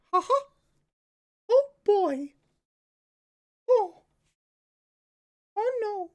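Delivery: squeaky, very high falsetto; Mickey. Acted. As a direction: chuckle and exclaim as a cartoon mouse.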